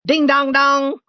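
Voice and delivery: high sung ding-dong like a ship's bell; bell imitation. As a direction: ring it out like a riverboat bell. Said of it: "shout again and say".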